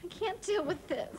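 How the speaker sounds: high, distressed protest; upset. Acted. Overwhelmed woman to Herman after his joke, upset. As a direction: plead it high, close to losing control.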